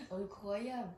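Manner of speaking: usual speaking voice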